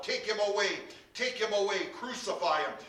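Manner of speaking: loud, high, rapid-fire demands; shouting out. A narrator's reading. Crowd rejects their king before Pilate, shouting out. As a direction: shout each demand like an angry mob. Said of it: "they shouted out".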